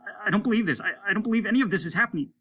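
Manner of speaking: high, fast stammering voice; a stunned crewman in disbelief. Acted. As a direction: stammer it in shock. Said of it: "said Stimson".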